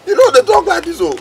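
high, wailing reproach; distressed, high-pitched complaint; upset objection. Acted. Upset man objecting to friend.

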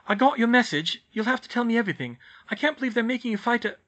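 fast, high and hurried; breathless rush. Acted. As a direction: blurt it all out in a rush. Rushed, out of breath.

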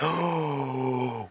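a sharp gasp of sudden realisation; sharp excited gasp. Real, not acted. Podcaster gasps at a sudden plot theory, excited gasp. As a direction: gasp as the idea hits you. Sudden idea about Barry.

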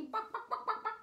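high, quick run of clucks; funny hen clucks. Shown as a demonstration. Host showing kids the hen's noise, high clucking. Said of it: "she makes that funny bark".

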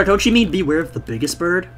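normal talking voice

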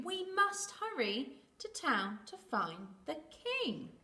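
high, drawn-out plea to hurry; lightly voiced chick urging the others on; urgent chick.

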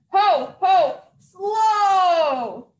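loud crying howl as he crashes; loud panicked Santa howl. Acted. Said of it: "Santa howled right before he crashed".

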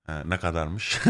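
low, soft mock price query; imitating Kemal. Friend checking a shop price, lightly mimicked low voice. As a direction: put on a friend's low, quiet questioning voice. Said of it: "Böyle yapıyor hemen".

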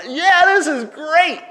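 loud, high burst of glee; excited whoop. Retold or imitated. Kid first popping up on water skis, excited whoop. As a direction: crow it like a thrilled kid. Acts out kid's first moment up.

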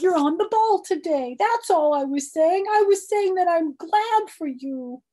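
put-on very high, loud pleading girl voice; May pleading. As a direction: beg in a squeaky high little girl voice. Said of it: "This is May talking".

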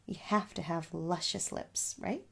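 ordinary talk

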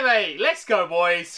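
usual speaking voice

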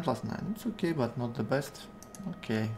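ordinary talk, nothing marked

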